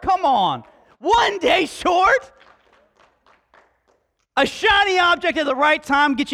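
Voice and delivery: builds from plain talk to a full preaching shout; rising to a shout. Real, not acted. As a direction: climb until you're shouting at them. Preacher rallying the congregation.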